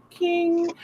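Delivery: high, drawn-out 'King'; imitated movie line. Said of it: "turn to the camera and go".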